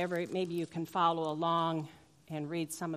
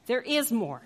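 emphatic repeat; 4 semitones higher, 6 dB louder than usual. Pretend. Repeating the infomercial line.

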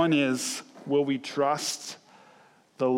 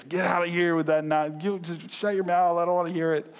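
louder, higher mock door slam rebuke; mock angry brush-off; 5 semitones higher, 4 dB louder than usual. Pastor mimics homeowners slamming doors, mock angry. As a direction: snap them away like an annoyed homeowner.

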